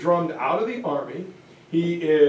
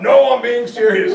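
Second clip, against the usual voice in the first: louder, insisting he's serious; insistent; 4 semitones higher, 8 dB louder than usual. Retold or imitated. Churchill insisting to dismissive Parliament, loud. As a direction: push back hard.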